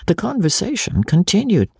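ordinary delivery, nothing marked

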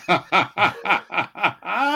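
long burst of real laughter. Real, not acted. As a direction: crack up at the quote. Reaction to the eyewitness quote.

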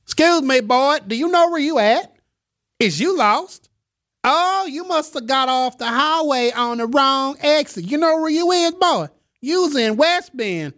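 hammed-up Southern accent in falsetto. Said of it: "people in West Bend have Southern accents".

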